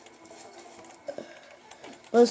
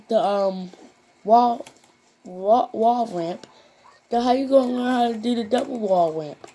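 higher, louder mock-outraged viewer asking how; louder, higher pitched imitation of a critic; 4 semitones higher, 5 dB louder than usual. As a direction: ham up a doubting viewer. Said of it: "But I know what half you gonna say".

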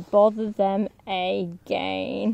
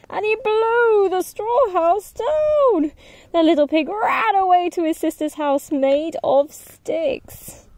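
higher, excited storytelling; dramatic narration; 8 semitones higher, 5 dB louder than usual. A narrator's reading. Narrator telling the wolf blowing houses down, excited and lively. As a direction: build the suspense for the kids.

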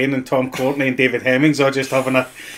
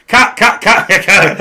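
Hoskins calling cut; 8 semitones higher, 10 dB louder, much slower than usual. Retold or imitated. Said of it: "Bob Hoskins went".

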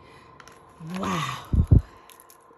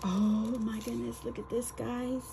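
usual speaking voice

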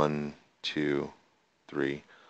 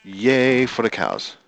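mock cheer; 6 semitones higher, 7 dB louder, slower than usual. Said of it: "I'm like".

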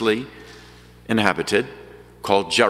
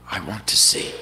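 whispered plea; 4 semitones lower than usual. Retold or imitated. Blind man whispering his wish to Jesus. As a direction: barely breathe the words out. Blind man's humble answer.

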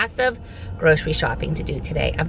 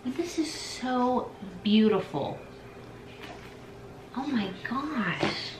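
slow hushed awe; 5 semitones higher, 8 dB quieter, slower than usual. Real, not acted. Admiring gift.

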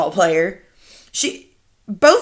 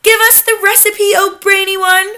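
high, louder eager demand; impatient crying out; 11 semitones higher, 7 dB louder than usual. A narrator's reading. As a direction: beg your leader impatiently. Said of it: "cried the audience impatiently".

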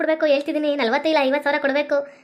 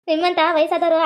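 ordinary talk

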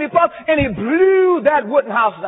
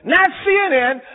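ordinary delivery

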